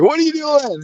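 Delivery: high, startled disbelief; shocked question. Retold or imitated. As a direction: squeak it out in shocked surprise. Kid just swung at him.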